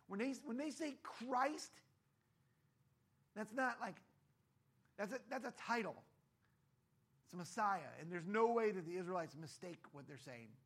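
emphatic preaching. Acted.

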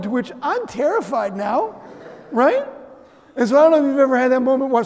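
normal talking voice

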